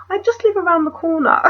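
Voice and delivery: high-pitched imitation of an arty man; lightly mocked, high-voiced artsy invitation; artsy fartsy. Photographer coaxing a stranger to his apartment, mock artsy voice. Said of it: "he sounded really artsy fartsy".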